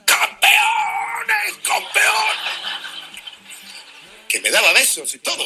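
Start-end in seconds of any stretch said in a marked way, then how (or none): 0.1-2.4 much higher yelled chant; shouting in the ear. Retold or imitated. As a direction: scream it into someone's ear. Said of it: "empieza a gritarme a la oreja".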